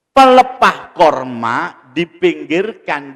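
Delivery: loud, emphatic sermon delivery; preaching. Real, not acted. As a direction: project it to the whole mosque. Preaches at full force. (ceramah lantang penuh penekanan)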